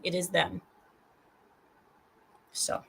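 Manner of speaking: ordinary talk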